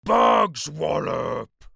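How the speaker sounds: loud, drawn-out giant's bellow; booming. A narrator's reading. Creature dismissing the BFG's answer, booming. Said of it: "he boomed".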